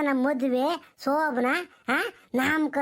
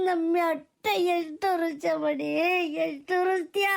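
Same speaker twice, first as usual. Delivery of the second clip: slow, tearful lament; crying. Acted. Parent in despair over a daughter's choices, crying. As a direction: break down and wail the words out. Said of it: "ಯಾಕಲ್ಲ ಅಳ್ತೀಯ? ಅಳ್ಬೇಡ ಸುಮ್ಕಿರೋ".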